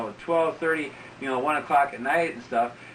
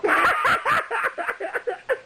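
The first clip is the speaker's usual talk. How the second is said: long high laughter; real high laugh. Real, not acted. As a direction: burst into laughter. Laughing at own story.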